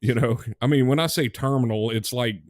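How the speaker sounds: normal talking voice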